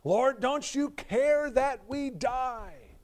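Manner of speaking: high, slow desperate cry; panicked plea. Acted. Disciples in the storm begging Jesus, high frightened cry. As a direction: drag out the terror of drowning men. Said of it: "they say".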